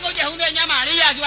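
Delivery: ordinary talk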